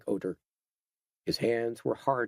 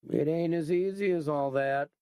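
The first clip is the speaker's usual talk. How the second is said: a hint of a thoughtful, folksy biker, pitched higher; thoughtfully; 6 semitones higher than usual. A narrator's reading. Gang captain explains to the captive girl, lightly voiced and thoughtful. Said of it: "he said thoughtfully".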